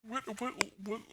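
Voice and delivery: ordinary talk, nothing marked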